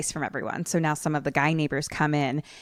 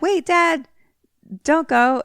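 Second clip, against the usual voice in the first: high, loud mock-kid plea; child calling Dad; 11 semitones higher, 8 dB louder, slower than usual. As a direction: imitate a boy calling his father back. Voices child calling to his dad.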